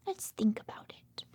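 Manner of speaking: hushed musing; whisper. Acted. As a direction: whisper it as a secret. Whispering suspense.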